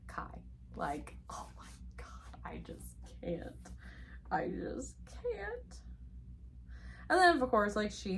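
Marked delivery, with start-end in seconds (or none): 0.1-3.7 overwhelmed, hushed whisper; swooning whisper. Real, not acted. Overwhelmed by favourite book line. 4.3-5.8 soft, very high, drawn-out squeak; overwhelmed swoon. Real, not acted. Overwhelmed by favourite book line.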